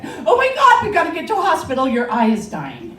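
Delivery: high, loud panic that rushes then slows; a shrieking husband in alarm, breaking into a laugh; husband shrieks in panic about the eye; panicked shriek. Retold or imitated. Husband panics over her eye.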